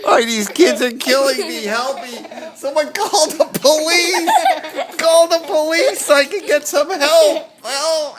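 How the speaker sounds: high, loud laughing cries; mock plea for help. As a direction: playfully call for help.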